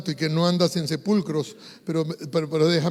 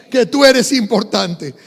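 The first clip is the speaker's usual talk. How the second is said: emphatic preaching; 4 semitones higher, 8 dB louder, slower than usual. Real, not acted. Preacher driving point home to congregation.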